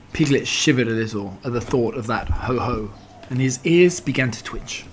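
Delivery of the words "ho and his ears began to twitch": ordinary delivery, nothing marked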